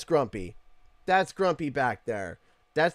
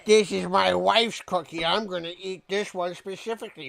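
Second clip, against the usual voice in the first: higher, smug cookie thief; mock greedy voice; 5 semitones higher, 6 dB louder than usual. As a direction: ham up a smug dibs claim. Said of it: "I didn't be like".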